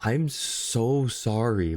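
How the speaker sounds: slow, tender apology; heartfelt sorrow. Real, not acted. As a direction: draw it out gently, as if comforting. Consoling a hurt character.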